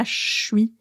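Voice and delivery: "je suis" fused into one syllable, "chui"; contracted je suis. Learners ask teacher about it, "je suis" contracted to "chui". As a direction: squash "je suis" to "chui", starting with sh. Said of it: "des contractions, euh, qu'on va utiliser".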